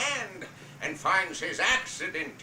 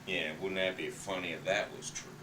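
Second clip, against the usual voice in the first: low, dry scornful jab; a cruel husband mocking his wife's love; Big Daddy sneering; 9 semitones lower, 4 dB quieter than usual. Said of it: "And Big Daddy said".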